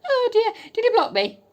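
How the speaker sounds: high, loud, squealed mock panic; shrieking alarm. Real, not acted. Wondering if the man blocked her, high squeal. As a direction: squeal it, half laughing.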